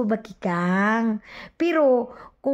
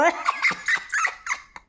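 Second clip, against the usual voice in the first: breathy, high sneering laugh; high mocking laugh; 4 dB quieter than usual. Real, not acted. Vlogger mocks her rival, high airy laugh.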